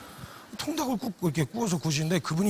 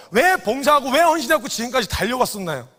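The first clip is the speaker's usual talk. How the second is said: ordinary talk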